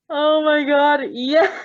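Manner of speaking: loud, high burst of delight; excited shout. Real, not acted. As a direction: yell it out in glee. Reader reacts to the story.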